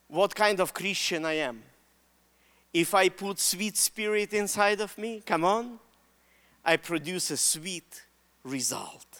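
soft, low, reflective preaching; solemn appeal. Real, not acted. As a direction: let it settle quietly on the congregation. Solemn close of the sermon.

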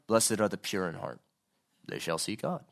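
low, subdued recital without pleading; detached recitation. Acted. Preacher showing how Jesus did not say it, detached. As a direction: recite it matter-of-factly, low and unmoved. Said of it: "I'm just not thinking he's going".

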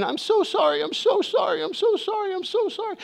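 high-pitched imitation of a frantic child; imitated screaming. Crying son repeating apology to his dad, mock screaming. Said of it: "he just keeps screaming".

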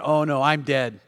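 low, sinking inward dread; doomed resignation. Retold or imitated. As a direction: think it aloud as if defeated. Friend's doomed thought, dropped voice.

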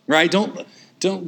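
usual speaking voice

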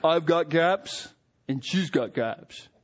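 a lightly voiced Rocky; Rocky quote. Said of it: "and he says".

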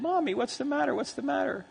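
put-on high, soft little-boy voice; small child's worried question. Toddler asking his weeping mother what's wrong, high worried child voice. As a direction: ask it softly and high, like a toddler.

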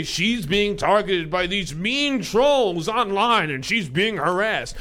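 slow, drawn-out mock outrage; put-on pleading on someone's behalf; mock sympathy. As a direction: sarcastically play up the victimhood. Mocking the paper's sympathetic statement.

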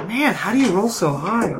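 mock-wounded whine, pitch swooping up and down; sing-song protest at bad luck; exasperated complaint. Real, not acted. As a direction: wail it at the dice. Groaning at dice rolls.

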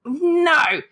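usual speaking voice

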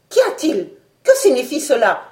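high, loud alarmed questions; alarmed cry. Acted. The doctor rushes to the porthole, alarmed cry. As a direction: call out in sudden alarm. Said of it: "s'écria le docteur".

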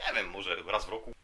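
normal talking voice

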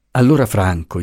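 ordinary delivery

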